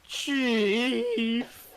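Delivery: high, loud whining "Chief!"; whiny sob. Acted. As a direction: whine it like a fake sob. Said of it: "sobbing in a whiny voice".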